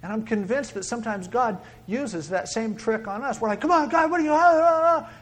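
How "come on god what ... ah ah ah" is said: high, loud impatient babble; mock yelling. Noisy people demanding God speak up, mock yelling. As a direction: shout over everything in mock frustration.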